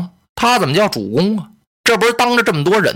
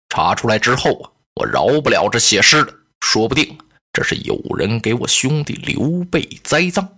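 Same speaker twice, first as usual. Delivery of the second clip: deliberate lord; 6 semitones lower than usual. Acted. Liu Biao tells Cai Mao not to march, lower and deliberate. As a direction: weigh every word like a cautious lord.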